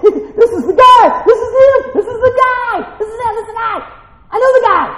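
high-pitched, giddy acted shouting; hammed-up excited squeal; excited crowd. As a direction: point him out like a thrilled onlooker. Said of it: "Be excited".

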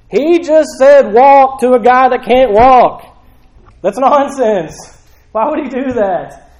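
loud, high, drawn-out disbelief; incredulous. Acted. Bible reader reacting to "walk" command, incredulous. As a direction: burst out baffled at what was just read.

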